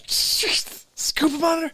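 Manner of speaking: slow whispered scuba monitor, high and breathy. Acted. Repeating phrase in disbelief.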